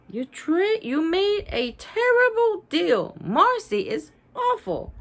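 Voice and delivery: Lucy protesting the trade; indignant. A narrator's reading. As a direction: scold him for the bad trade. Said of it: "Lucy says".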